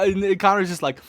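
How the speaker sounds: usual speaking voice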